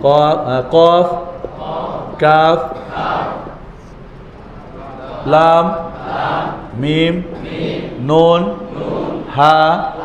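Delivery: drawn-out letter names, each rising at the end; letter drill recitation. Shown as a demonstration. Teacher leads class through the letters, measured rising chant. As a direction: call each letter singly, leaving room for echoes.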